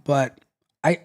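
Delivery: ordinary delivery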